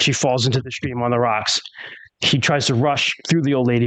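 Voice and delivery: ordinary talk, nothing marked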